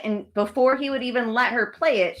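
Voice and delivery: normal talking voice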